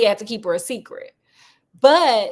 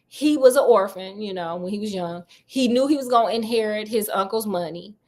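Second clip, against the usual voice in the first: ordinary talk, nothing marked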